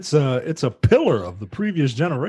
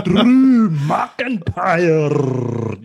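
put-on Scottish accent; 7 semitones higher, 5 dB louder, much slower than usual. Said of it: "I can do a Scottish accent".

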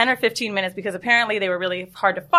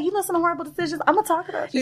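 higher, softer teasing aside; higher soft playful voice; mock hushed tease; 6 semitones higher, 5 dB quieter, much faster than usual. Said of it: "I was like".

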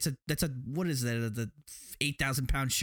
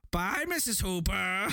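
high, shouted sing-song taunt; mocking taunt; 6 semitones higher than usual. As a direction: call out like a schoolyard bully. Mocking taunt at Brody's wife.

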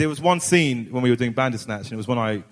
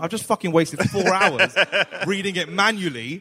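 laughing; 8 semitones higher than usual. Retold or imitated. Retold exasperated reply.

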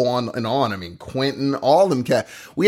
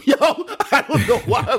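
laughing; 9 semitones higher than usual. Real, not acted. Laughing at himself.

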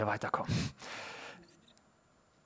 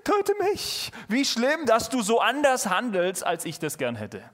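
put-on whiny baby; 5 semitones higher than usual. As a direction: whimper it like a sulking child. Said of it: "So, so ein Baby".

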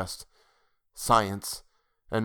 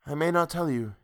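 sighing; 6 semitones higher than usual. A narrator's reading. Woman withholding her destination from her love, lightly sighing. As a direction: sigh the words lightly. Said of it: "she sighed".

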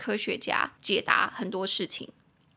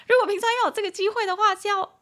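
excited; 11 semitones higher, 5 dB louder, faster than usual. Real, not acted.

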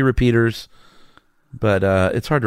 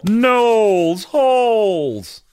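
Kermit the Frog voice; 12 semitones higher, much slower than usual. Acted. Host announces his segment.

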